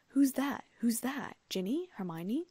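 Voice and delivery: high, alarmed questions in the dark; startled calling in a dark train; startled calls. A narrator's reading. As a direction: call out, bumping into someone unseen.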